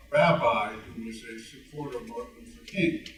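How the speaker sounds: normal talking voice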